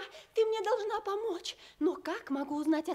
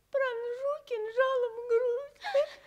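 tearful vow; 5 semitones higher, slower than usual. Acted. Princess vows to stab herself.

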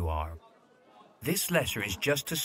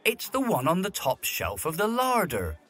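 much higher, louder no, then sharp; exasperated snap; 8 semitones higher, 3 dB louder than usual. Acted. Butler snapping at the footman who got it wrong. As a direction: cut him off in disbelief.

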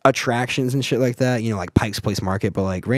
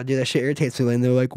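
ordinary talk

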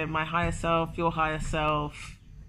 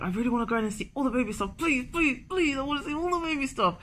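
high, fast pleading please; whiny little-girl plea; childlike begging; 6 semitones higher, faster than usual. Retold or imitated. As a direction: beg like a kid in a toy shop. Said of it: "I was like".

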